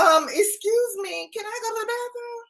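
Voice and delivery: high, fast pleading whine; exasperated mock plea. The owner pleading with her dog, exasperated mock whine. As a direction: beg for privacy with mock outrage. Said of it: "I was like".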